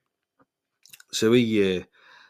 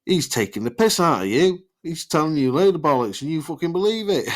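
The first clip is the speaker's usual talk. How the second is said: higher, louder jeering from the fella; mocking scoff; 8 semitones higher, 6 dB louder than usual. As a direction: scoff to the girl that it's all bollocks. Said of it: "he goes".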